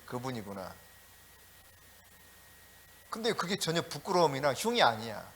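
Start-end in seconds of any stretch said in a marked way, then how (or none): 0.1-0.7 quiet realization. Retold or imitated. Congregation's unbothered recognition.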